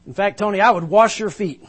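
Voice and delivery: loud, high, effusive tribute; gushing praise. Retold or imitated. Introducer gushing over Tony before the crowd, loud and high. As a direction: gush over your hero. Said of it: "he looked at Tony and said".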